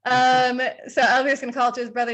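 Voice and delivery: usual speaking voice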